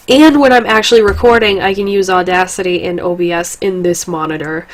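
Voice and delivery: ordinary talk, nothing marked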